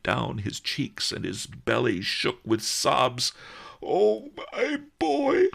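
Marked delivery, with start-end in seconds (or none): 3.8-5.5 much higher, louder weeping cry. Acted. Merchant grieves over the boy, high weeping. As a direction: wail it through tears. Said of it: "he wept".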